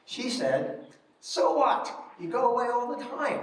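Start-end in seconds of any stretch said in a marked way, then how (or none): 1.2-3.4 mother waving off her son, scoffing; mother's scoff. Retold or imitated. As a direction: brush him off as his dismissive mother. Mother dismissing son's news.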